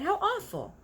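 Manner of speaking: high, shocked little cry; a child appalled at the thought; girl crying out in horror; horrified girl. A narrator's reading. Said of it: "Sophie cried".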